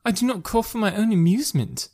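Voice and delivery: put-on high-pitched, fretful girl; put-on high voice of a peeved daughter. A narrator's reading. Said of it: "replied Kitty fretfully".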